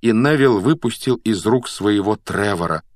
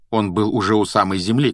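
plain reading voice

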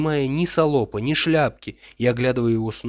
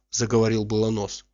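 ordinary delivery, nothing marked

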